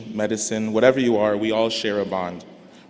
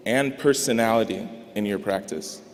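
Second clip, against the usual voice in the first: usual speaking voice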